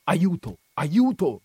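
high-pitched narrated cry of alarm; wild cry for help. A narrator's reading. Don Abbondio crying out into the night, acted cry for help. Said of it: "quel primo sgangherato grido".

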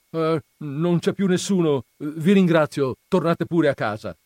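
nervous priest calling down to the crowd; priest at the window. Acted. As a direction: send the crowd home, soothing but loud. Don Abbondio dismisses the crowd.

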